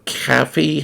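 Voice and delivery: "caffey" with an open, unrounded first vowel; non-East Coast pronunciation. Shown as a demonstration. Streamer guessing how non-East Coasters say coffee, non-East Coast "caffey". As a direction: say "caffey" softly for coffee, as "CAH-fee". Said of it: "How do non-East Coasters say coffee?".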